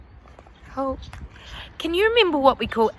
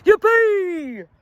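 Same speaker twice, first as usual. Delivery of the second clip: high, loud squeal of glee; storybook whoop of joy; joyful cheer; 7 semitones higher, 6 dB louder than usual. A narrator's reading. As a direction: cheer like excited little dinosaurs. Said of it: "the Tees cried out".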